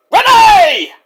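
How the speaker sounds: very high, loud holler; Roddy yelling. Retold or imitated. Wrestler hollering for Renee, yelled impression. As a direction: yell the name out at the top of the voice. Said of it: "Roddy comes in and yells".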